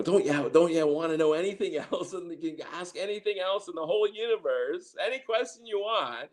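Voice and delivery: high, amused teasing from the ladies; playful high mockery with a chuckle; teasing laugh. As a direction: laugh at him while asking, pitched well up. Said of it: "they always used to laugh at me".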